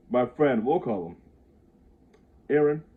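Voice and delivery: ordinary delivery, nothing marked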